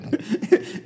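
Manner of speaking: real stifled laugh; breathy laugh. Real, not acted. Fake Trump photo amuses him.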